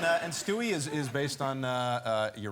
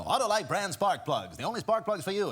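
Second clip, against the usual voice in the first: a hint of a high fifties announcer; old radio ad man; 6 semitones higher, 3 dB louder than usual. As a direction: sell it like a forties radio commercial. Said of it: "everyone had this high-pitched voice".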